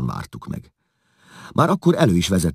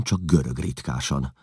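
ordinary delivery, nothing marked

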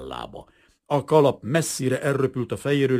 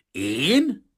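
higher, louder, indignant growl; growled retort; 5 semitones higher, 6 dB louder than usual. A narrator's reading. Kazimír told to flee by Rozita, growling indignantly. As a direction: snap back in indignant disbelief. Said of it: "mordult föl Kazimír".

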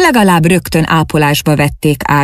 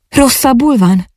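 higher-pitched, concerned question; worried question; 8 semitones higher than usual. A narrator's reading. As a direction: ask with natural, mild alarm. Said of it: "kérdé az természetes aggodalommal". (aggódó, magasabb hangú kérdés)

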